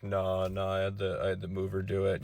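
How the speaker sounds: low, soft mock-dude voice; imitating an indifferent guy, low and soft; unhelpful dude. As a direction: quietly brush it off as the lazy guy. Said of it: "He's like".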